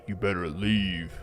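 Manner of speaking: slow, lightly put-on stranger's voice; mock mysterious man. Made-up man warning a camper off, slow mock-menacing voice. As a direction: draw it out as a joke warning. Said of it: "was a man saying".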